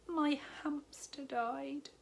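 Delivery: high, slow whispered confession; sad whisper. Acted. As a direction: breathe out the sad news in a whisper. Sad confession after deep breath.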